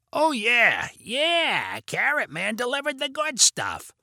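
slow, high, exaggerated raspy voice; Carrot Man. A narrator's reading. Vinny announcing himself to the kids, exaggerated raspy smoker's voice. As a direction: exaggerate a smoker's rasp, drawn out. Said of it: "Vinny kept going with the voice".

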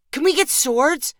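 put-on high-pitched hopeful kid; eager child. A narrator's reading. Boy asks his dad for a sword, eager.

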